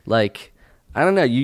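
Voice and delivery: ordinary delivery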